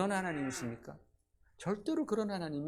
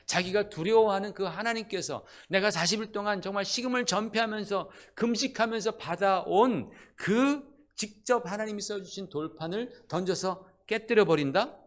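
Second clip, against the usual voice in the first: indignant preaching; 5 semitones higher, 5 dB louder than usual. Acted. Indignant preaching about broken tablets.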